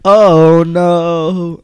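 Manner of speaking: loud, drawn-out mock horror; hammed-up loud lament; mock dread. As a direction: wail it out in playful alarm. Echoes co-host's mock horror.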